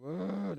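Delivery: groan, fed up; exasperated groan. Real, not acted. Same meme comes up yet again.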